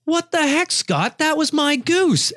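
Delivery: high indignant protest, drawn out slow; mock outrage. Hunter to friend over stolen goose, high indignant whine. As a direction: complain the goose was stolen. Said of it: "And I'm like".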